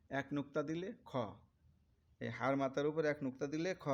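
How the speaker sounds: ordinary delivery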